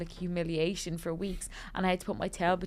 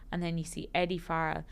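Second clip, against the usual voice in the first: normal talking voice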